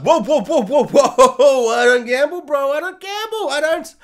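hammed-up husband protesting he doesn't gamble; defensive protest. Husband denies gambling to his wife, loud mock protest. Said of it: "he's all like".

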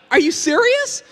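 high, loud disbelieving question; incredulous. Retold or imitated. Speaker to friend who didn't get the movie, incredulous. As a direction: blurt it out in disbelief. Stunned at friend not getting it.

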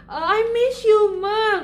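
put-on high, loud tearful childlike plea; a kid choking up over the phone; missing child. Twin tells mom she misses her, high teary voice. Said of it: "Ngomongnya gitu".

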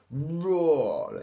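loud, high, falling roar; lion roar. A narrator's reading. Siblings correcting Little Lion, loud roar. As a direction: show him how with a roar. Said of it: "they said".

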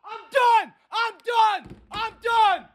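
high furious shouting; raging gamer. Real, not acted. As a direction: scream it out, fed up. Rage quitting.

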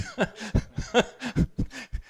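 real high-pitched laugh; a real high laugh at the audience. Real, not acted. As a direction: burst out laughing at the memory. Laughs at own story.

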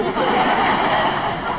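a loud breathy burst of laughter. Real, not acted. Guest finishing her story for the audience with a loud breathy laugh.

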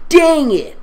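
high, loud "Dang it!"; frustrated outburst. Acted. Narrator voicing the girl's frustrated curse. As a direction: blurt it out in frustration.

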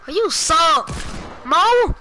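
yelled high and loud at a rival; loud teasing jeer; loud taunt. Real, not acted. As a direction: shout the insult across the lobby. Taunting opponent in game.

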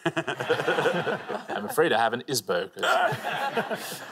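slow, laughing mock-posh confession; a hint of a posh Surrey gent, half laughing; lightly put-on posh English voice. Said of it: "someone very posh from Surrey".

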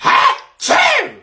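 high, loud fake sneeze. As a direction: blast out a sneeze.

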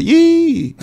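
high, mocking squeal of the devil; a light mock devil's jeer. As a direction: tease like a gloating demon, up high. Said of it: "eu vi a risada do cão".

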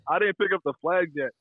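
ordinary talk, nothing marked

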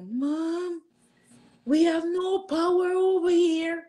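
high, lightly voiced scared daughter on the phone; daughter's voice. Daughter telling her mom there's no power, high and lightly voiced. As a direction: play the frightened girl calling her mom. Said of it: "she was like".

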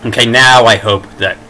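ordinary delivery

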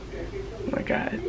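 breathy, overwhelmed whisper; emotional whisper. Real, not acted. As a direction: whisper it, overcome with feeling. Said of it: "I'm so emotional".

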